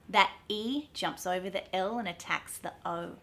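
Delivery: ordinary talk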